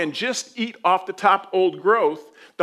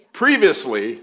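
mock TV recap announcer; 3 semitones lower, 5 dB louder, slower than usual. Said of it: "So buckle up, here we go".

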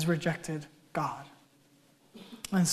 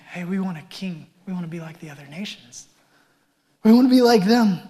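emphatic demand; 6 dB louder than usual. Retold or imitated. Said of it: "They're emphatic".

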